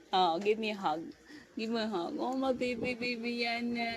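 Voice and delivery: hushed tender coaxing. Real, not acted. Mother cuddling her baby, hushed tender baby talk.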